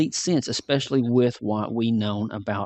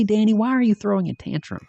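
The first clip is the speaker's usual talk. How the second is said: exasperated; 10 semitones higher than usual. Real, not acted. Exasperated rhetorical question.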